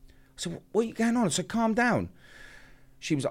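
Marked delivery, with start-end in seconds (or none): 0.5-1.3 high, urgent question; retold alarm. Retold panicked phone call. 1.5-2.1 high-pitched, urgent appeal; retold urgent plea. Retold reply to screaming caller.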